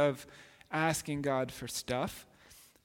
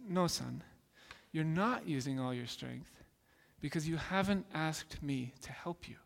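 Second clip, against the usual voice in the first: a hint of a calm father, softer; gentle father; 2 semitones lower, 7 dB quieter than usual. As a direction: quietly reassure the boy. Father answers son gently.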